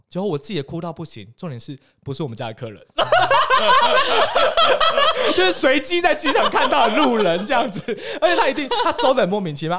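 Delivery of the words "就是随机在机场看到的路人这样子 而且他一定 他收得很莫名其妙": very high, loud laughing speech; shrieking laugh into words; helpless laughter. Real, not acted. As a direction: crack up and keep talking through it. Laughing at own absurd crying.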